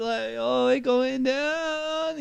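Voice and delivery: high, slow excited classmates; hammed-up class going ooh; class reacting. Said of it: "the class was like".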